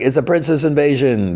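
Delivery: high-pitched mock alarm; imitated guards' warning; acted alarm cry. Panicked guards announce invasion, acted.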